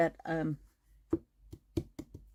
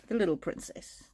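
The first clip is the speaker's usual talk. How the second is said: usual speaking voice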